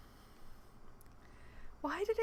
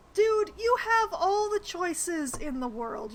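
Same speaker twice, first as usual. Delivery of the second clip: exasperated; 6 semitones higher, 6 dB louder, slower than usual. Real, not acted. Exasperated player talking to NPC.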